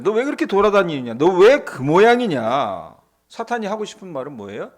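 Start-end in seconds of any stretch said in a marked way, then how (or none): 0.0-3.0 God scolding. Acted. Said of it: "하나님은 뭐라, 뭐라 그러셨어요?".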